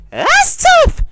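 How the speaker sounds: high loud yelp; excited shout. Real, not acted. Sudden shouted reaction.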